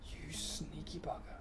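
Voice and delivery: whispered insult; whispering at a sneaky wolf. Real, not acted. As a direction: hiss it under your breath. Creeping up on hidden wolf.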